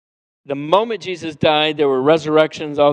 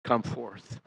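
simple command; 3 semitones lower, slower than usual. Retold or imitated. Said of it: "He simply says".

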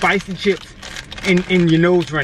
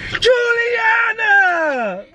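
high, drawn-out holler of a name; exaggerated high-pitched calling out; mocking yell; much slower than usual. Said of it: "That boy regular voice like this".

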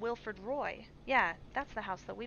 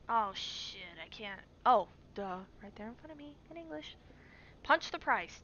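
ordinary delivery

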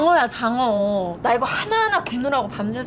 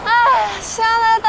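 exhilarated; 8 semitones higher, 6 dB louder, slower than usual. Acted. Enjoying cool wind up high.

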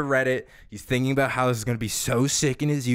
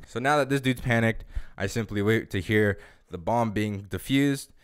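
ordinary talk

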